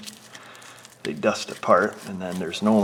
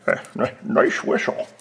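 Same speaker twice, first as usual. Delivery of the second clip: half-whispered Sean Connery lisp; Sean Connery impression; 3 semitones higher, 7 dB louder than usual. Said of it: "get Sean Connery to do that one".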